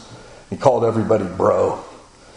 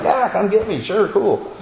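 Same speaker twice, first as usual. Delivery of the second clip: much higher, easygoing imitation of his pastor; breezy pastor voice; 10 semitones higher than usual. Imitating his pastor agreeing to lunch, casual and high. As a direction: toss it off like a laid-back friend. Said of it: "he's always like".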